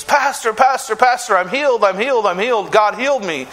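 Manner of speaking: high, eager calls of a healed woman; retelling her joyous shout of healing; excited joyful cry. Retold or imitated. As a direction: call out Pastor in breathless joy. She comes running up to me.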